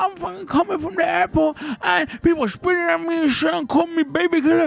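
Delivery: hammed-up weepy Rambo monologue; crying Rambo. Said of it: "when he's crying at the end of the movie".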